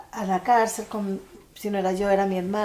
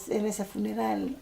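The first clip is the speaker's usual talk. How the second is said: ordinary talk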